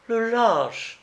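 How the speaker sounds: high-pitched outraged exclamation; indignant cry. A narrator's reading. Young woman after being shot at, high indignant cry. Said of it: "s'écria la jeune fille".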